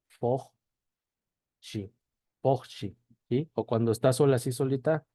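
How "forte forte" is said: "forchi" with the final E as a chee sound; Brazilian pronunciation. Shown as a demonstration. Teacher demonstrating a word for learners, final E pronounced as I. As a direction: say "forchi" in two clear syllables, Brazilian style.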